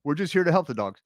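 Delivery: mock-exasperated, emphatic telling-off; exasperated parental refusal. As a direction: act out a parent reminding the kids. Parent refusing crying girls' plea.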